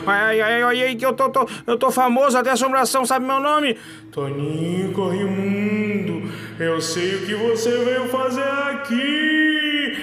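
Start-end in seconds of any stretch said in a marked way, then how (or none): 4.1-10.0 slow, sing-song phantom moan; ghost voice. Acted. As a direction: float the name like a spirit. Ghost addresses the visitor.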